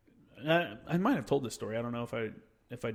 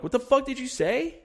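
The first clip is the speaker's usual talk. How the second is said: high-pitched, indignant retold challenge; angry challenge; 10 semitones higher than usual. Marine confronting a mouthy kid, angry challenge. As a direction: act out taking offence, pitch jumping up. Acted confrontation over backtalk.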